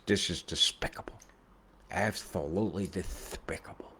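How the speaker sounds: whispered cartoon duck lisp, hushed; Daffy Duck. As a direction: breathe it out like a scandalised cartoon duck. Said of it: "And Daffy Duck has says".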